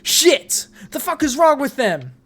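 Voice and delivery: high, loud frustrated yell; alarmed shout. Acted. Taking cover, alarmed.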